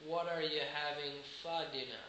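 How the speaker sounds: normal talking voice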